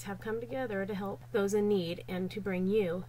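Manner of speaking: ordinary talk, nothing marked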